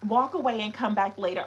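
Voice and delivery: ordinary delivery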